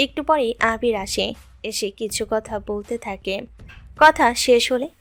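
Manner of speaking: ordinary delivery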